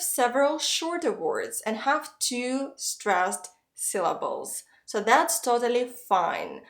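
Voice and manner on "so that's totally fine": ordinary talk, nothing marked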